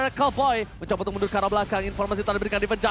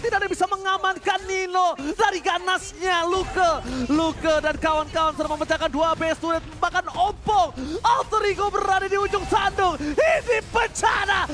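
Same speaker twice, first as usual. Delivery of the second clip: fighting game announcer; 6 semitones higher than usual. Acted. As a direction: call the final push at full hype. Hype play-by-play of final push.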